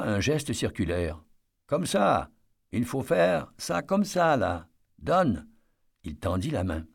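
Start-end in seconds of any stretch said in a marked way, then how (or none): 1.7-5.5 raised, high coaxing call. A narrator's reading. Eddie coaxes the guard to hand over stones, raised voice.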